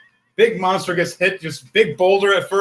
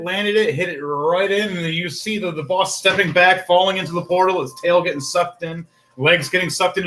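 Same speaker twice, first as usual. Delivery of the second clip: ordinary talk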